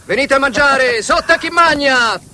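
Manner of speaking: high, hammed-up watermelon seller's call; street vendor's cry. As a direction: holler like a market stall seller. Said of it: "si mette a gridare a squarciagola". (grida da cocomeraro, molto più acuto)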